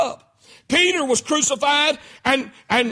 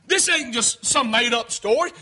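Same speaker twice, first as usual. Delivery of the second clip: usual speaking voice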